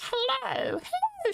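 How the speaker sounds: high, airy falsetto greeting; falsetto hello. Real, not acted. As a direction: squeak a tiny high hello. Greeting a viewer.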